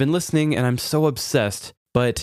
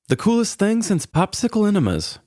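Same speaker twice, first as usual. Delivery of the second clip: goofy high punchline; 11 semitones higher than usual. Acted. Punchline of show intro.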